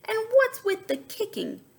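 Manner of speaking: high, indignant question; annoyed at being kicked; scolding. Acted. Scolding the bluebird.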